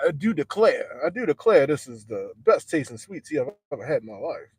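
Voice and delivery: hammed-up old Southern gentleman accent, drawn out on "declare"; mock Southern accent praising the sweet tea; Southern drawl like Foghorn Leghorn. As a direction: play a cartoon Southern gent declaring his delight. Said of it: "I almost started talking like Foghorn Leghorn".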